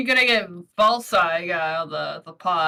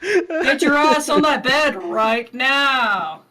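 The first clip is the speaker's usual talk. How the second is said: hammed-up dirty talk; 3 semitones higher, 4 dB louder, much slower than usual. Joining dirty-talk bit.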